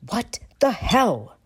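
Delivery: slow, hissed angry whisper-shout. A narrator's reading. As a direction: shout without raising volume. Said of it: "Sirius whisper shouts".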